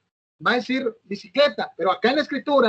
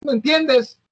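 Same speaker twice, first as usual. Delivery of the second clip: higher, offhand brush-off voice; a shrugging, careless excuse in a put-on higher voice; mocking dismissive quote; 6 semitones higher than usual. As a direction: toss it off like someone who won't admit a mistake.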